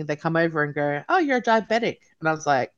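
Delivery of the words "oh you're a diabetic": breezy, higher-pitched casual announcement; nonchalant. Retold or imitated. Hospital staff casually delivering a diagnosis, nonchalant. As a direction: toss it off lightly, imitating offhand staff. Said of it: "very nonchalantly".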